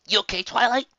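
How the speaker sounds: a hint of a boy's call; lightly voiced Spike. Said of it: "Spike asked".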